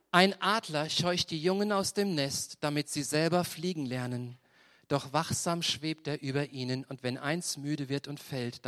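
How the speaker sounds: solemn Bible reading voice; scripture reading. Acted. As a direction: read the verse with weight. Reads Bible passage slowly.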